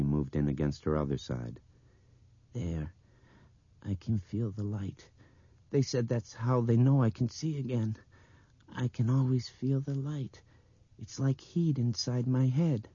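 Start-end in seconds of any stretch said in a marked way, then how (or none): 2.5-12.9 put-on higher, dreaming child's voice; dreamy little girl. A narrator's reading. Blind girl telling the others she feels light, dreamy. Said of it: "dreaming voice".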